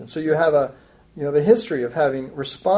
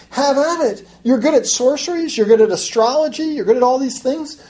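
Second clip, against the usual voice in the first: high-pitched mock encouragement; sarcastic praise, pitched up; 6 semitones higher than usual. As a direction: egg them on with sarcasm. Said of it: "in a very sarcastic voice".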